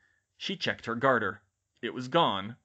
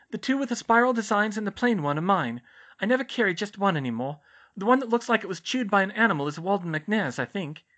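high, raised student voice; Hermione's character voice; 9 semitones higher, 6 dB louder than usual. Acted. Hermione accounting for her wands to the nurse, high character voice. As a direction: explain earnestly in a higher voice.

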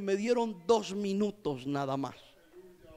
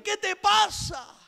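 urgent call; 9 semitones higher, much slower than usual. Retold or imitated. Pleading with the weeping man.

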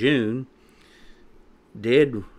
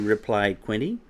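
ordinary delivery

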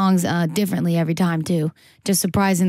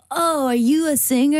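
higher mock voice of a clumsy flirt; mock guy; 8 semitones higher than usual. Singer imitates a club guy asking if she sings, mock high voice. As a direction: play the guy asking, hammed-up. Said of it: "And then he was like".

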